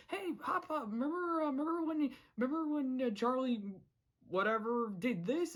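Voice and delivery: high mock cartoon voice; Sprig. Imitating Sprig distracting Hop Pop, high put-on voice. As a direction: pitch it high, stumbling through a distraction. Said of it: "even Sprig uses it as a distraction".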